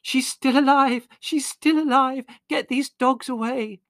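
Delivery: very high, loud, slow cry; mother frantic, dogs tugging her nightie. A narrator's reading. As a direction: cry out in relieved panic.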